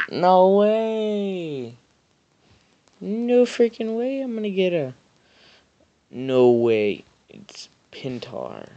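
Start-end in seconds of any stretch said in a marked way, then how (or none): 0.1-7.0 high, stunned no way; excited disbelief. Real, not acted. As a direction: gasp it out in disbelief. Rare pull appears on screen.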